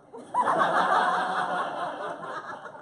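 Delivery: high breathy laugh burst; loud laugh. Real, not acted. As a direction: let a big laugh out. Comic laughs at own line.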